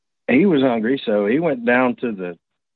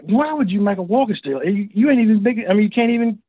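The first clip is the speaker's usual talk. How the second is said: high-pitched, exasperated retold scolding; acted-out incredulous nagging; irritated; 8 semitones higher than usual. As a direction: complain like fed-up friends. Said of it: "irritated everybody else".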